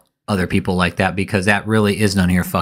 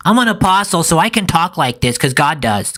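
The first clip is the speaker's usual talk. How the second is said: high, mocking imitation of a self-declared prophet; 7 semitones higher than usual. Commentator mocking the preacher's claim to be a prophet, high mock-pious voice. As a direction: mimic his holy claims in a raised, mocking voice. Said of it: "'Cause he's, Oh, he's".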